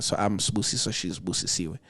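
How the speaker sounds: normal talking voice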